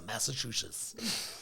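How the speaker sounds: slow, drawn-out hint of a bad American accent. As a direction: stretch it out in a mock American accent. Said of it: "say it in that bad accent".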